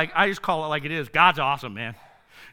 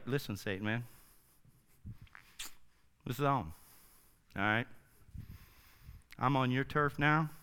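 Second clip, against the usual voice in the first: a touch of tough talk; 9 semitones lower, 12 dB quieter than usual. Retold or imitated. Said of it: "he's like".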